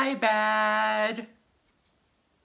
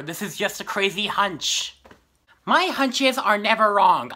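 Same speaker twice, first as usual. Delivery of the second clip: normal talking voice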